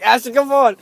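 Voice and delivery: urgent high call to a friend. Retold or imitated. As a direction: yell for him to hurry up.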